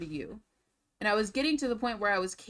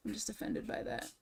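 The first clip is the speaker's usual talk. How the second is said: whispered offense; 10 dB quieter than usual. Real, not acted. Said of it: "I'm so offended".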